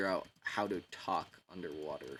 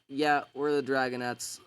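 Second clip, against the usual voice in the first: higher, louder showy brag; boastful brag; 5 semitones higher, 7 dB louder than usual. Retold or imitated. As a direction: show off to everyone, cocky. Dragonets boasting to the crowd.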